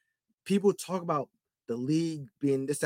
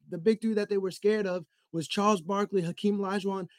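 ordinary talk, nothing marked

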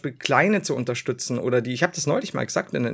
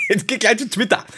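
mock commenter voice; 8 semitones higher, 4 dB louder than usual. Mocking angry commenters.

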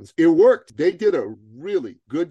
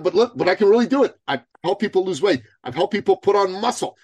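higher-pitched, earnest mock persuasion; eager retold pleading; 7 semitones higher, 4 dB louder, faster than usual. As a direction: imitate a keen client, high and insistent. Eager client pleading his case.

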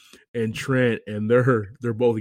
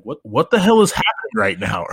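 raised, incredulous retold outburst; mock disbelief; 5 semitones higher, 4 dB louder than usual. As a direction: act out the friends' startled reaction. Acting out the friends' alarmed reaction.

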